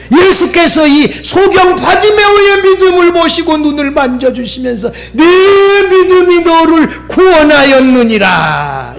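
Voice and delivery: impassioned sermon climax; full-force preaching. Real, not acted. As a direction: urge the congregation fervently. Preaches the quoted blessing.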